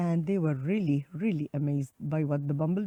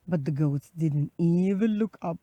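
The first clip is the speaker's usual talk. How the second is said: ordinary delivery, nothing marked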